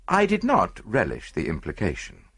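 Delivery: ordinary delivery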